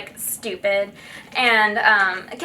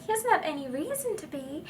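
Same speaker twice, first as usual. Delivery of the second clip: Southern Belle; 6 semitones higher, 6 dB quieter than usual. Pretend. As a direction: lightly play a sweet Southern Belle. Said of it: "I didn't say it, like, so, like, Southern Belle".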